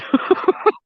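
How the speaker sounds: airy high giggle; short unvoiced laugh; breathy laugh. Real, not acted. Laughing at own joke.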